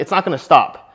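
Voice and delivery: normal talking voice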